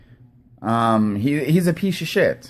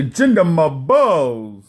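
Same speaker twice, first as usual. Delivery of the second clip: mock New York accent, drawn out; 3 semitones higher, 4 dB louder, much slower than usual. Host scolding podcaster's pronunciation, exaggerated New York accent. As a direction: drag the name out in a cheap New York accent. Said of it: "the New York accent".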